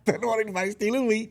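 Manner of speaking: high, laughing mock whine; laughing mock Gideon. Host laughing as she mocks Gideon guarding wheat. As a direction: giggle through a mock of the timid hero.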